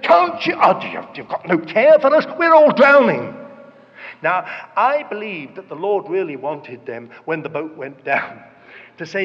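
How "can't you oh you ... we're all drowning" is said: loud, high mock panic; alarmed disciples. Disciples shaking the sleeping Jesus awake, panicked shouting. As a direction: cry out in acted reproach and alarm. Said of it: "they shook him and said".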